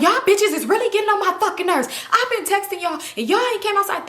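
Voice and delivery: high-pitched irate rant; mad friend. Retold or imitated. Friend left waiting outside tells the girls off, mock angry. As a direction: imitate a fed-up friend telling everyone off. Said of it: "She's mad".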